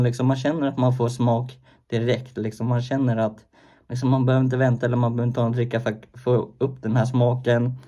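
talking with pouch in lip; slightly bulged upper lip; snus under upper lip. Real, not acted.

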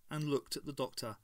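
ordinary delivery

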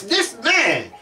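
ordinary talk, nothing marked

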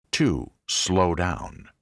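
drawn out, each word weighted; 強調してゆっくり読む見出し; slow emphatic heading. Acted. Sermon point heading, said slowly.

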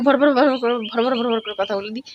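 fast rattled-off demo; rattling fast. Shown as a demonstration. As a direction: rush the words together. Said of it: "একটু স্পিডে কথা বলি আমি".

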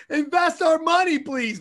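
high, loud pleading, hammed up; mock begging girls. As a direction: beg like an eager stripper, half joking. Girls begging a coked-up investor.

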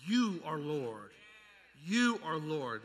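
each word slow and weighty; slow solemn declaration. Acted. Preacher declaring God is Lord, slow and solemn. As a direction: lay it down slowly, like a vow.

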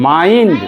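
মাইন্ড stretched as "maa-eend"; long আই sound. Shown as a demonstration. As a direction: rise through the glide in মাইন্ড, then fall. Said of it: "কই আইটা কই করলি?".

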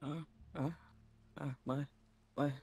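soft, halting, stammering wounded voice; hurt winged character. Acted. Injured winged friend reunited and comforting, soft halting stammer. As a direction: stammer softly through the pain. Emotional roleplay scene between characters.

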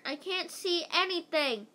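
high-pitched mock shout, little extra volume; a storybook shout from below. A narrator's reading. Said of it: "Missus Mentry shouted".

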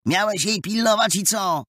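put-on high, excited boys calling out; clamouring calls. A narrator's reading. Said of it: "jeden przez drugiego wołali".

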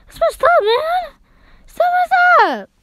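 high, loud, voice cracking. Real, not acted. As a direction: yell it till the voice breaks. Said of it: "I think my voice is cracking".

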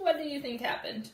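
ordinary delivery, nothing marked

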